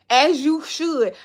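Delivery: as you should stretched slow, sassy; drawn-out as you should. Shown as a demonstration. Approving a viewer who leaves reviews, drawn out.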